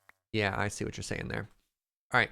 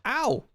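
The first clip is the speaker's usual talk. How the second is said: pained yelp; 13 semitones higher, 5 dB louder than usual. Real, not acted. Hurt in game.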